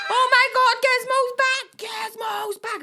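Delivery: high, loud squeal, slowing at the end; excited child. Retold or imitated. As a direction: shriek with joy like a six-year-old. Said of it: "And I was like".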